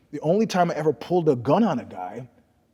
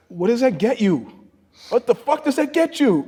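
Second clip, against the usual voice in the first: incredulous; 9 semitones higher, 4 dB louder than usual. Real, not acted. Incredulous rant about bought belts.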